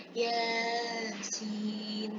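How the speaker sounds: Yasin stretched on a level note; elongated Quranic reading. Shown as a demonstration. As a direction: softly draw out ya and sin. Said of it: "pada huruf ya-nya ini ada harokat berdiri".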